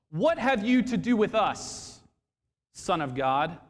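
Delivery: high, loud demon cry; demons shouting. Acted. Demons shouting at Jesus from the tombs, loud and high. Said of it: "Suddenly they shouted".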